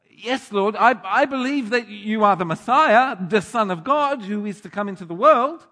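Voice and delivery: loud, high-pitched confession of faith; fervent declaration. Acted. Acting out Martha's confession of belief.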